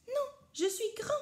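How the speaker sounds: high, indignant little voice; a small boy insisting he is big; protesting child. A narrator's reading. Rikili protesting to papa, high and indignant. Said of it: "proteste Rikili".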